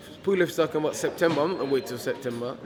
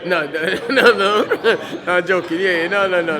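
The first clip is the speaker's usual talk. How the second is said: laughing; 3 semitones higher, 7 dB louder, slower than usual. Real, not acted. Caught joking, bursts out laughing.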